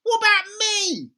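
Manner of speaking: put-on high, loud jealous old woman; grandma shouting down for attention; grumpy grandma shouting. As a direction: demand attention, shrieking.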